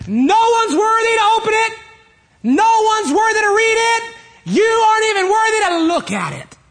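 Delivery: high, loud declaring; booming proclamation. Acted. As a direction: thunder it out to everyone. Angel's loud proclamation continues.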